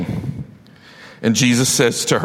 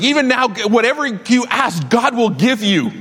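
Martha blurting hope; 9 semitones higher than usual. Acted. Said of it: "And says, like".

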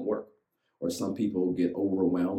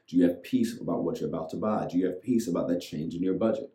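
ordinary talk

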